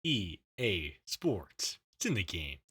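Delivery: slow, low game announcer; deep announcer voice. Pretend. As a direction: growl out the EA slogan slowly. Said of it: "Come on".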